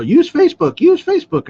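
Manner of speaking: high, loud repeated mock shout; mock screaming. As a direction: shout it like a marketing guru. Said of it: "is screaming".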